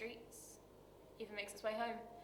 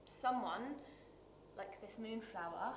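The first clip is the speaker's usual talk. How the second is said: tender, hushed; 2 semitones lower, 7 dB quieter than usual. Acted. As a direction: let the last thought drop away softly. Tender, hesitant close of monologue.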